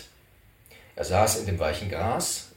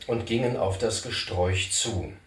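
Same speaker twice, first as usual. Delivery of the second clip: ordinary delivery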